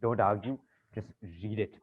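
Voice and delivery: hushed, weary exasperation; exhausted pleading. Acted. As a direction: plead quietly, worn right down. Worn-out banker begs assistant to comply.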